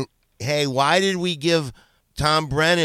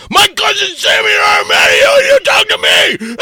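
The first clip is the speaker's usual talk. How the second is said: screaming, much higher and louder; furious shout; 11 dB louder than usual. Real, not acted. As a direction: blow up, yelling at him. Furious shouting over phone.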